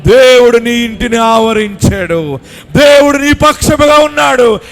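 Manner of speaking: loud, high, driving sermon climax; fervent revival preaching, voice lifted high; full-force preaching. Acted. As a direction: preach it to the back of the tent. Preaching climax over music.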